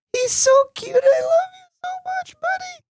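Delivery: very high, crying-like gush; high doting squeal to his son. Real, not acted. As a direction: squeal your love at the picture. Cooing to baby photo.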